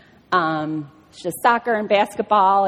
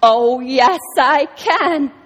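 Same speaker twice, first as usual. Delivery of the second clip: emphatic God; 5 semitones higher, 5 dB louder, much slower than usual. Acted. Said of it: "He says".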